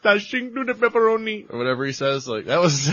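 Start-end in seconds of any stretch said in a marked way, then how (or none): 0.0-1.5 high, loud bad Jamaican accent; fake Jamaican accent. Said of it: "that was not Jamaican".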